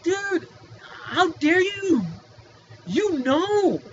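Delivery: high, loud, slow how-dare-you; indignant outrage. Real, not acted. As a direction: let the disbelief burst out. Outraged at the doctor.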